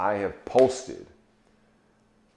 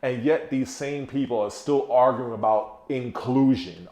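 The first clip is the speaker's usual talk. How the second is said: ordinary delivery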